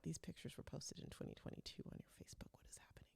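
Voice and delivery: hushed, breathy disbelief; alarmed whisper. Retold or imitated. Realizing the puppy photos are old, alarmed whisper. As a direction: whisper it like a shocking discovery. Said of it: "I'm like".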